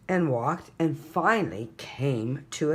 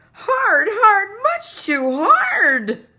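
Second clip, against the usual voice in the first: very high, much louder, slow yell; 10 dB louder, slower than usual. A narrator's reading. Little brother yells about the gorilla hug, very high loud slow yell. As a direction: bellow it like a squashed little boy. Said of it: "yelled Tate".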